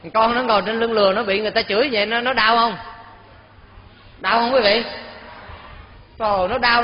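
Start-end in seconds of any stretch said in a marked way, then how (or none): none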